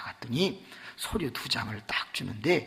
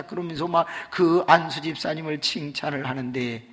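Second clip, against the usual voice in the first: usual speaking voice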